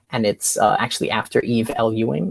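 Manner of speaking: ordinary talk, nothing marked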